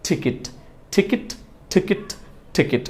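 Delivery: "টিকিট" softly as ti-kit, pausing after each; correct pronunciation drill. Shown as a demonstration. Teacher drills ticket for learners, correct pronunciation. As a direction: drill "টিকিট" quietly with short i twice. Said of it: "সঠিক উচ্চারণটা যদি আমরা করি".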